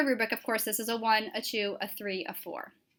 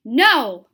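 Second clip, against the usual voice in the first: No! shouted much louder, a burst of shock; stressed no; 11 dB louder than usual. Shown as a demonstration. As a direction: punch the word No! hard and loud. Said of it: "So I emphasized no".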